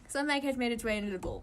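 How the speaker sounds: plain reading voice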